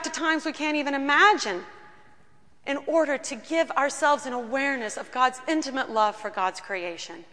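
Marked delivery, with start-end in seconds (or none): none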